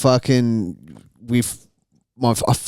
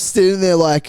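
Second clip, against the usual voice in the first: ordinary talk, nothing marked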